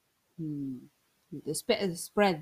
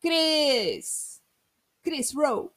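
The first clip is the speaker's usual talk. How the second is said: calling out; 7 semitones higher, 4 dB louder, much slower than usual. Pretend. Mock-calling Ronaldo's nickname.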